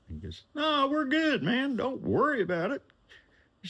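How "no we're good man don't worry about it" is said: high, breezy rich man; high client voice. Retold or imitated. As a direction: pitch way up and wave it off. John voiced reassuring him.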